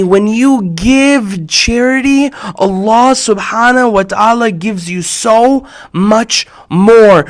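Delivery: slow, solemn sermon voice; preaching. Acted. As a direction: deliver it like the sheikh to the crowd. Preaches to the congregation.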